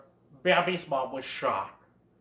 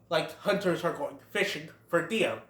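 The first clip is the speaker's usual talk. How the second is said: ordinary delivery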